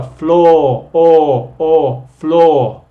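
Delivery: floor and its o said loud, the vowel isolated; teacher drilling the vowel in floor. Shown as a demonstration. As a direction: stretch the o in floor and hit it loud. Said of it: "Eso es el sonido vocal".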